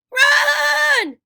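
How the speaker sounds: high, loud goat-like bleat; bleated shout. A narrator's reading. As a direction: bleat it out in panic. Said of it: "Grover bleated".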